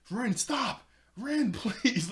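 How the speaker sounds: high, loud playful cry of alarm; mock alarmed plea. [name] begging flaming Ren to stop, mock alarm. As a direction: plead urgently, hammed up with a laugh.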